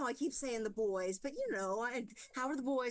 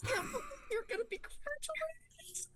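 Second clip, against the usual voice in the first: high, teary, choked-up mom; tearful mom. Acted. Mom tearing up over her son, high and teary. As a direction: get choked up with pride. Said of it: "she starts, like, crying a little bit".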